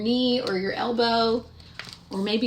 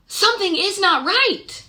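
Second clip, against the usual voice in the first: mock alarm; 9 semitones higher, 6 dB louder than usual. Miss Clavel senses trouble at night.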